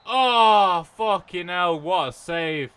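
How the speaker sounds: loud, high groan at a save; frustrated exclamation. Real, not acted. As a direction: shout out at the missed chance. Near miss reaction.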